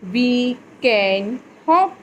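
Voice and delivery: put-on slow, carefully read sentences for children; slow reading aloud. Shown as a demonstration. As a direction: read it out slowly so kids follow. Teacher reading slowly to class.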